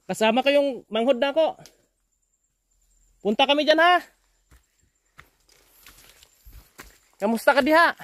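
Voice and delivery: high-pitched calls across a distance; calling out. Real, not acted. Visitor announcing his arrival to the old man, calling out. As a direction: call out to announce yourself.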